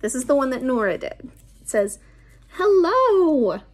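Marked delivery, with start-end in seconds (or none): 2.5-3.6 high bright greeting as a cartoon virus; cheerful virus voice. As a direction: play the friendly virus saying hello. Virus character greeting children.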